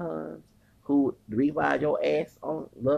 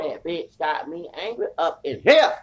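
usual speaking voice

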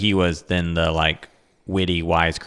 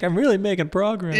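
mock high voice; 12 semitones higher, 4 dB quieter than usual. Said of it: "and he's like".

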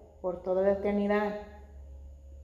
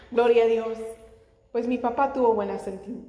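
normal talking voice

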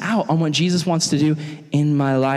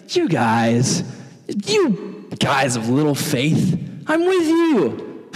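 exasperated chiding; much slower than usual. Acted. Jesus chides the panicking disciples.

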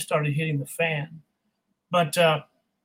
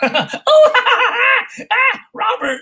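laughing out loud, high; 6 dB louder, much slower than usual. Real, not acted. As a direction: break into real laughter. Bursts out laughing at the question.

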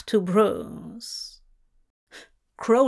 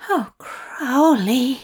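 slow groaning moan; groaned; 3 semitones higher, 3 dB louder, slower than usual. A narrator's reading. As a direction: groan the name out slowly. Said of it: "Zira groaned".

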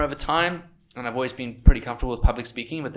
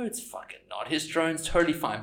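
ordinary talk

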